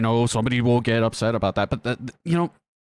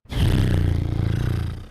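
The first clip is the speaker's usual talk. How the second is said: long sigh; 7 semitones lower than usual. Real, not acted. Reacting to customer abuse, long weary sigh. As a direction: heave a heavy breath out.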